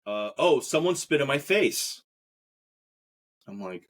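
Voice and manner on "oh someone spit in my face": exclaiming. Retold or imitated.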